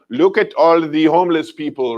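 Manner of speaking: lightly attempted German accent; bad German accent. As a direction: try a German accent, not very convincingly. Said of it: "probably not a good German accent".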